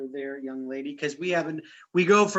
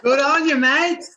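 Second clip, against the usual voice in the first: loud, high mock Australian "Good on you, mate"; Aussie cheer; 10 semitones higher, 9 dB louder than usual. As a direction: echo the Aussie cheer with gusto.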